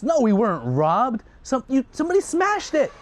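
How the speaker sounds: high, loud and worked up; agitated father. Acted. Father telling his son the idols were smashed, agitated. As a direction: spit out the news, upset.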